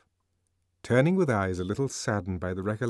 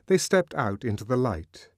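plain reading voice

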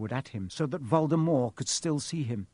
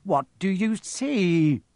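louder, higher, slow demand; impatient; 5 semitones higher, 5 dB louder, slower than usual. A narrator's reading. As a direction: press for the answer, stretching each word. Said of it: "said Quirrell impatiently".

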